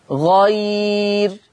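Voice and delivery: غير drawn out very slowly, ending on a thin r; light ra in pausing. Shown as a demonstration. Teacher shows the stop for all readers, long-held غير. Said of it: "مرققة للجميع".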